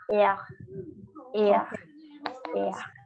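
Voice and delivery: "Ea" ending in a Spanish J sound; French R like J. Shown as a demonstration. Teacher shows class the French letter R, "Ea" ending in a J sound. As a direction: say "Ea" with a throaty J-like R at the end. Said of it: "como si hicieran una J al final".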